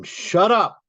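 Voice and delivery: sudden high, loud shriek of command; high-pitched scream. A narrator's reading. Poet voicing the enraged father in a poem, high scream. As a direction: scream it high, cutting through the reading. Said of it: "in a high pitch scream".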